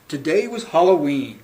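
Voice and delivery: ordinary delivery, nothing marked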